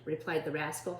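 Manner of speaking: ordinary delivery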